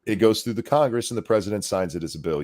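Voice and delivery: ordinary talk, nothing marked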